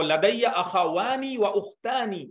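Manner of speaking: لدی اخوانی و اختانی said slowly for repeating; slow model sentence. Shown as a demonstration. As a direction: model it slowly for the student to copy. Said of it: "بولیے". (آہستہ نمونہ جملہ: لدی اخوانی و اختانی)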